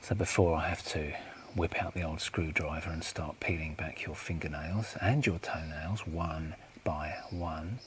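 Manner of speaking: soft, controlled threat slowing at the end; understated, hushed intimidation; quiet menace. Acted. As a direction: threaten softly, stretching the final words. Enforcer threatens torture quietly.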